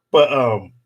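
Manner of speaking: ordinary talk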